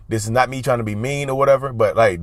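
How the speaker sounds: usual speaking voice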